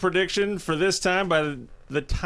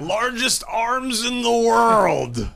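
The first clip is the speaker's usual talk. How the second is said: slow, high booming wrestling promo voice; Scott Steiner impression; 8 semitones higher, 4 dB louder, much slower than usual. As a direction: stretch it out like a muscle-bound wrestler cutting a promo.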